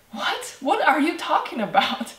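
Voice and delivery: high-pitched, disbelieving question; a baffled "what?" up high; incredulous. Acted. Imagined listener's incredulous reaction.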